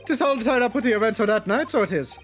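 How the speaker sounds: lightly voiced Irish old man; Irish accent. Voices Irish witness in game.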